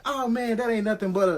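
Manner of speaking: high, startled voice of the partner. Retold or imitated. Partner blurting as the light vanishes, high and startled. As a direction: cut off mid-sentence in shock. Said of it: "And he like".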